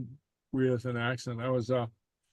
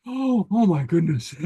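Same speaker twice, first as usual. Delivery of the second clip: amused exclamation; 5 semitones higher, 4 dB louder than usual. Real, not acted. Reacting to her own gossip.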